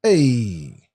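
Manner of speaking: ordinary talk, nothing marked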